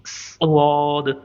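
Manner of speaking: स्वाद drawn out slow and gentle from the throat; soft throaty swad. Shown as a demonstration. Teacher showing students a soft, slow स्वाद. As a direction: let स्वाद out very softly and slowly. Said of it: "इंतहाई नरमी के साथ".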